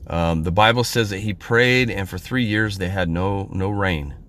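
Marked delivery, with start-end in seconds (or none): none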